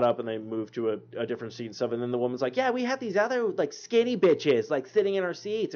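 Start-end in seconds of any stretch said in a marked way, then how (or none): 2.6-5.7 higher, mocking voice of a rude moviegoer, slowing on skinny; mock snooty woman. Mocking the woman who complained about people in her seats, in a higher sneering voice. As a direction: sneer it like the entitled woman he overheard.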